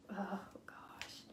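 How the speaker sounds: breathy whisper under the breath; whispered oh gosh. Real, not acted. Whispering to herself over her messy hair. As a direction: mutter it to yourself, barely voiced.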